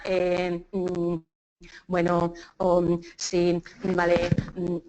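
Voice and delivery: low, monotone drawn-out fillers; filler-laden hesitation. Shown as a demonstration. Teacher showing speakers full of muletillas, droning hesitation. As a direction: stall on one low note between fillers. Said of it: "las dichosas muletillas".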